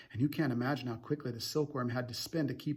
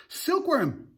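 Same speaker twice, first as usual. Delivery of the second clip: much higher, loud call; urgent yell; 13 semitones higher, 5 dB louder than usual. A narrator's reading. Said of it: "cried, yelled James".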